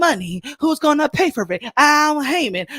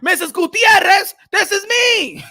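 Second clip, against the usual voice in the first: defiant retort; 7 semitones higher than usual. Retold or imitated. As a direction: fire it back at the teacher. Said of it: "I said".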